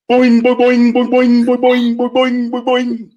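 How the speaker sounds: comic springy drum ring; ringing boing. Shown as a demonstration. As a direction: mimic a drum ringing like a tub. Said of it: "and it's going".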